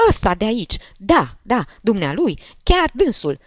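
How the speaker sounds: high, loud accusation; excited old woman pointing out the culprit; jabbing, high-pitched insistence; agitated old woman. A narrator's reading.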